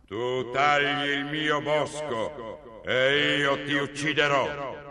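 slow, booming troll threat. Acted. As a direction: drag out each word like a giant monster. Troll threatens the second son. (voce di troll lenta e minacciosa)

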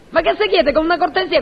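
usual speaking voice

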